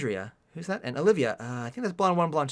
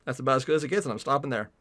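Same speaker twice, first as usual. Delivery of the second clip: usual speaking voice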